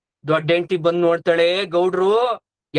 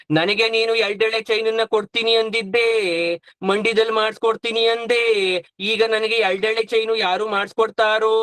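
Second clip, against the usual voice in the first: hammed-up sing-song mourning; mock wailing; 2 semitones higher than usual. Younger wife lamenting her promised gold chain, mock wailing. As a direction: chant the lament with long trailing vowels. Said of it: "ಬಡ್ಕೊಂತಾವ್ರೆ".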